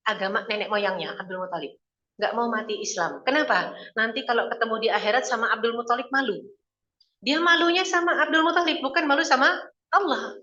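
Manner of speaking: usual speaking voice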